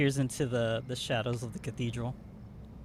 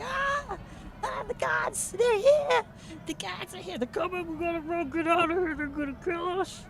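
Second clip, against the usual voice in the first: crowd shrieking in very high voices; panicked screaming; 4 dB louder than usual. Acted. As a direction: cry out as a terrified fleeing mob, hammed-up. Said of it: "screaming, like".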